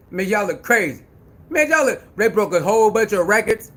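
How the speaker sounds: ordinary talk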